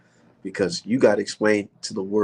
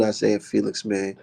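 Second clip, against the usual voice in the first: usual speaking voice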